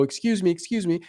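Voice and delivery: timid approach. Acted. Acting timid approach from side.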